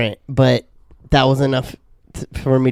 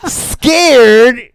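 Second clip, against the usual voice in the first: high loud incredulous "Scared?"; high loud mock disbelief; mocking surprise; 10 semitones higher, 9 dB louder than usual. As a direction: imitate the office lady scoffing. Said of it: "And the lady goes".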